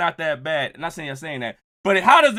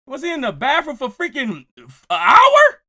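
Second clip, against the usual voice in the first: laughing disbelief; 6 semitones higher, 6 dB louder than usual. Real, not acted. Incredulous, crying with laughter.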